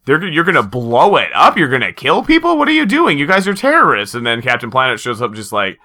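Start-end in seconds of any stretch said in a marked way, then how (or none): 0.0-4.1 high-pitched horrified scolding; climbing into squeaky mock outrage; Planeteers. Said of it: "at first the Planeteers are like".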